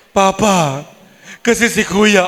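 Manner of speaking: put-on high child pleading to papa; a hint of a whiny daughter; child tattling. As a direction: daughter tells papa about her brother, lightly voiced. Father and daughter voiced.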